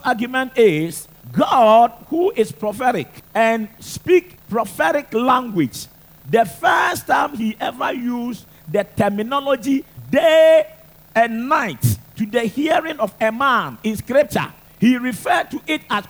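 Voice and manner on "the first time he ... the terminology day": day punched high and loud; stress on day. Acted. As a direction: hit the word day hard. Preacher hits the key word.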